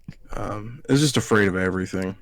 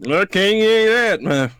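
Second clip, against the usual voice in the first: high, loud, drawn-out refusal; mock refusing aunt; 10 semitones higher, 5 dB louder, slower than usual. Nephew mimicking dairy-free aunt refusing the pie, mock refusal. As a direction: stretch out an exaggerated, whiny no.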